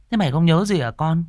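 high, plaintive question; distraught mother. A narrator's reading. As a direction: ask your son in a high, worried voice. Distraught mother questions her son.

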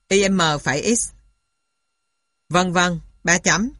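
ordinary delivery

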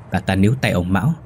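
ordinary delivery

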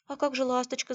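high anxious question; worried. A narrator's reading. Наталья asks after the horse, high and concerned. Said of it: "забеспокоилась Наталья".

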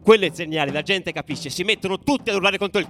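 loud, high, breathless telling; excited narration. A narrator's reading. Narrator recounting the stadium chaos, loud and excited. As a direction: race through the chaos loudly.

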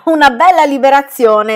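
loud, high cry of relief; excited exclamation. Acted. Fan cheers the aunt's exit, loud and excited. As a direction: cheer that the aunt is gone. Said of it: "esclamò Fan".

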